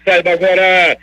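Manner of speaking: drawn-out, ringing crime-report intro; dramatic radio announcer. Acted. Radio host introducing morgue news to listeners, dramatic announcer delivery. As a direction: herald the segment with stretched vowels.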